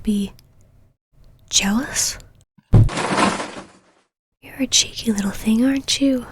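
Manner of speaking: intimate menacing whisper, close to the ear; whispered roleplay. Acted. Possessive partner taunting a lover pinned to the wall, whispering. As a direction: purr every threat in a hushed whisper.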